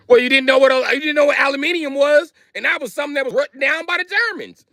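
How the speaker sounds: very high-pitched comic rant; mock exasperation. Telling off a confused customer, high mock exasperation. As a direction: squeal it out in exaggerated disbelief.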